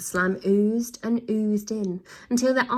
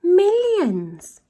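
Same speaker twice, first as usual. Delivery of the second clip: high, louder chuckling boast; chuckled; 11 semitones higher, 8 dB louder than usual. Acted. Villain wife chuckles a boast, high and loud. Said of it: "his wife chuckled".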